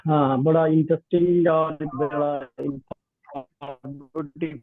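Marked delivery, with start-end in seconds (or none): 1.9-4.6 broken-up phone call audio; cutting-out phone line. Real, not acted. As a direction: voice dropping out over a bad line. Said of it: "sir, आपकी आवाज cut रही है".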